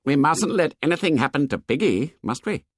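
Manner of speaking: oddly constricted, uneasy character voice; queer, tight voice. A narrator's reading. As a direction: say it as if the throat is tight. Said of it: "spoke in a queer, tight voice".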